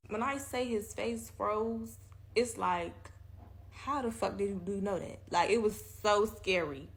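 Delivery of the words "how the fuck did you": ordinary talk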